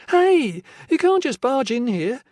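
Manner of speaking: high, loud protest; a small woman shouting at an intruder; indignant woman. A narrator's reading.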